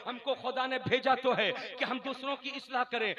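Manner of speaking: ordinary delivery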